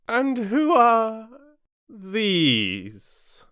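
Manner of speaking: loud, high, long-drawn demand; imperious Queen. A narrator's reading. Queen pointing to the gardeners, imperious loud demand. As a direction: stretch the question out high and loud.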